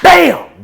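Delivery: explosive, high shouted "bam"; sudden imitated impact; loud slap sound effect. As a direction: belt out the smack.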